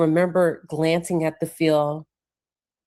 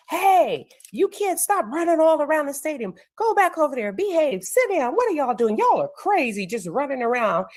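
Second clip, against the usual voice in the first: high-pitched telling-off, lightly acted; mock yelling; 10 semitones higher than usual. Coach scolding kids running round the stadium, mock yelling. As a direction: imitate a coach calling rowdy kids to order. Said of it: "He's yelling at the kids".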